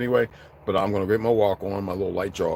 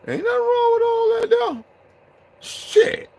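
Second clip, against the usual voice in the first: falsetto mock voice; 3 dB louder, slower than usual.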